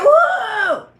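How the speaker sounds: loud startled whoa through a laugh. Retold or imitated. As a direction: recoil from the smell out loud. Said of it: "I said".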